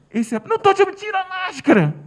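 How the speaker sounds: high, loud rude shout; angry shout. Retold or imitated. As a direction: yell him down, annoyed. Rude woman yells at father.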